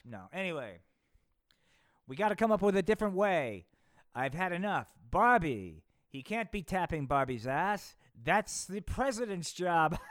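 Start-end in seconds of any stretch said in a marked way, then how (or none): 2.1-10.0 loud, higher, fed-up president ranting; angry JFK. Acted. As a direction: rant in a loud cartoon Kennedy voice. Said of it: "said J F K".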